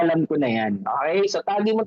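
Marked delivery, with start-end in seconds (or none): 0.0-0.8 "Alam ko na yan" said low and flat with no attitude; just name the phrase, dropped low; flat quoted phrase. Shown as a demonstration. Speaker names the phrase to drop, said low and flat. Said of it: "Yung word na".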